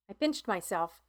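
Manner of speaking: ordinary delivery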